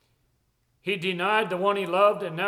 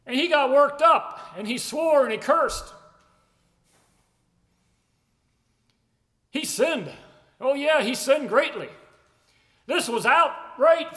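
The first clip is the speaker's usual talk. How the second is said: fervent, raised preaching; 4 semitones higher than usual. Acted. Preacher drives home Peter's sin and hope, fervent and raised. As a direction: build the sermon up with conviction.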